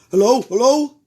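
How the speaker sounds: loud urgent hello; firemen calling hello. A narrator's reading. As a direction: shout it down the line. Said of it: "they said".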